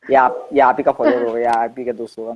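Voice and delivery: normal talking voice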